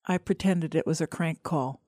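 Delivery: plain reading voice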